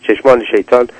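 ordinary delivery

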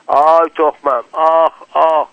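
high, loud cry of sudden pain. A narrator's reading. Hossein, struck in the groin, cries out in pain. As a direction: yelp as if hit hard. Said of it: "حسین فریاد زد".

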